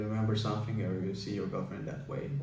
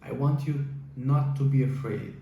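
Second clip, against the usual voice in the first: ordinary talk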